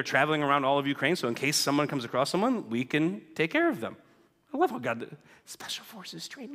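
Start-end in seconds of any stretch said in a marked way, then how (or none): 4.5-6.5 a private joke murmured under the breath; soft whispered aside to himself. Real, not acted.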